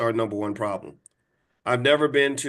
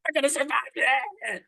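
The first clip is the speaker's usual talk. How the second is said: much higher, squealing mock alarm; mock sudden panic; 12 semitones higher than usual. Sleepers suddenly waking after Trump takes office, panicked squeal. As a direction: yelp like someone jolted out of sleep. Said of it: "suddenly they're like".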